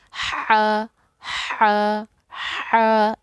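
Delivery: हा breathed from the throat, thin and loud; letter demo. Shown as a demonstration. Tajweed teacher showing students a thin throaty हा. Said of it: "कैसे?".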